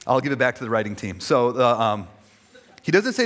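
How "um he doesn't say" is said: normal talking voice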